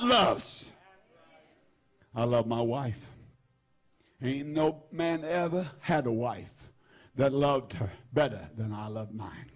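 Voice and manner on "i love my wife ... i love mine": a hushed, sincere confession of love; tender and hushed. Real, not acted. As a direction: drop low and soft, speak tenderly of your wife. Tender confession about his wife.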